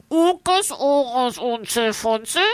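pinched nose, high voice. A narrator's reading. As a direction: hold your nose and repeat. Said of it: "Er hält sich die Nase zu und wiederholt".

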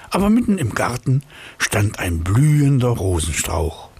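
ordinary delivery

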